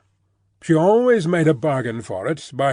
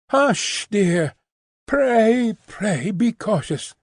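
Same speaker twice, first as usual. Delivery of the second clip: anxious plea; 7 semitones higher, much slower than usual. A narrator's reading. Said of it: "cried Lucy".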